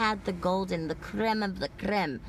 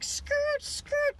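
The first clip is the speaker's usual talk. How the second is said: high, squealing mock tire screech. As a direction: screech it like tires peeling out. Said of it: "and I'm like".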